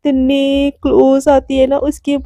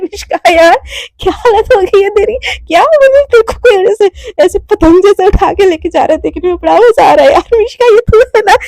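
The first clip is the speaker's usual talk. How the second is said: high, loud mocking giggly voice; amused high taunting; teasing laughter; 5 semitones higher, 5 dB louder than usual. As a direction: tease her while laughing. Romi laughing at carried Mishka.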